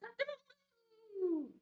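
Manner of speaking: very high, long cock-a-doodle-doo; rooster crow. Acted. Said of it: "He can sound like a rooster".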